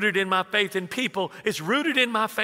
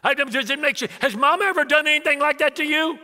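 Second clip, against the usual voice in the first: high, loud incredulous question in imitation; indignant son; 8 semitones higher, 4 dB louder than usual. Son asks dad about Mom, indignant. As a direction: demand an answer, high and worked up. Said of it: "That made me so mad".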